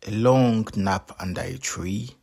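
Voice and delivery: ordinary delivery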